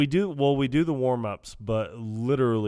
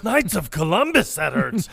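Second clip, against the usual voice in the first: loud exclamation; 8 semitones higher, 7 dB louder than usual. Retold or imitated. Quoting movie line.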